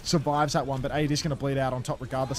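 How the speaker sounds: lively play-by-play, rising with the action; esports caster. Acted. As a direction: call the match like a live caster. Casting the match live.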